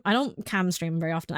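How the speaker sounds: usual speaking voice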